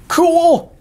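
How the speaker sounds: excited. Real, not acted. Host amazed by four-horned rams, excited high exclaim.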